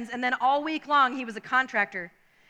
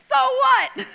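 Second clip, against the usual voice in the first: high, loud laughing scoff; mocking driver; 11 semitones higher, 6 dB louder than usual. Driver brushing off boy, mocking loud scoff.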